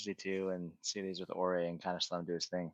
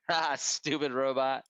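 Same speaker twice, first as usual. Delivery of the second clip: amused character voice; 5 semitones higher, 6 dB louder, slower than usual. Acted. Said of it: "Ori just starts laughing".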